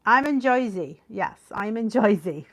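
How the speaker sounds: Jersey accent with "oi" in Jersey. Shown as a demonstration. Confirming to the group where she lives, Jersey accent. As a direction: lean into a thick Joisey accent. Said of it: "That's my Jersey accent sometimes".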